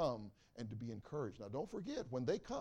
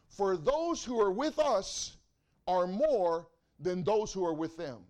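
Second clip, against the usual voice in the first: loud and slow, each word of "those who are with us" spaced out and hammered; booming slow proclamation; 5 semitones higher, 13 dB louder, slower than usual. Acted. Preacher voicing Elisha to his congregation, slow loud proclamation.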